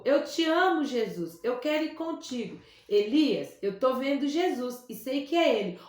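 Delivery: ordinary delivery, nothing marked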